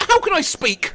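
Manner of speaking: high, bright slip of a greeting; high phone greeting. Retold or imitated. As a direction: chirp it up high like answering a call. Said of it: "I once answered the phone with".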